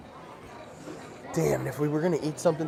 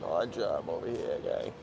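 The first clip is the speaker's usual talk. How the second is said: a hint of a gruff henchman voice; mock Bond villain; 7 semitones lower, 5 dB quieter than usual. Joking on stream, playing a Bond henchman, lightly gruff. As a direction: lightly growl it like a movie heavy. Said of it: "Like we're in freaking James Bond".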